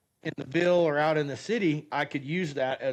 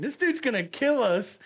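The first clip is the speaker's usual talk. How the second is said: high-pitched mock panic; comic dread, pitched way up; retold alarm; 8 semitones higher than usual. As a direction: blurt it as an amused, alarmed thought. Retold panicked thought during sudden braking.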